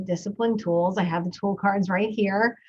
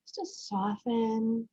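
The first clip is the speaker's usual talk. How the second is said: guided breathing. Acted. Podcast host guiding parents through breathing, in a calm meditation voice.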